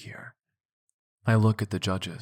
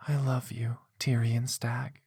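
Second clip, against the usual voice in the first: woman's voice; 6 semitones higher than usual. Acted. Female character voiced.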